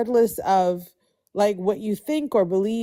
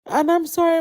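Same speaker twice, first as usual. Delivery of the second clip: emotional; 8 semitones higher, 4 dB louder, faster than usual. Real, not acted. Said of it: "I'm always so emotional".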